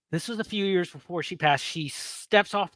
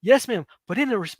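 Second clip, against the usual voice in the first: respectful nervous reply; 5 semitones higher, 3 dB louder, faster than usual. Retold or imitated. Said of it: "most respectful way possible".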